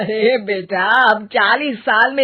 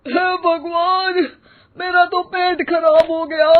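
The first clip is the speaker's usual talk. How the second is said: slow, higher moaning voice; groaning in pain; 6 semitones higher than usual. Acted. Father calling from inside about his upset stomach, moaning. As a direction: moan it out, clutching the stomach.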